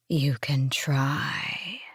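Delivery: very slow eerie whisper; otherworldly creature voice. A narrator's reading. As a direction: whisper it like something from another world, drawn out. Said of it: "it said in that voice that did not come from her world".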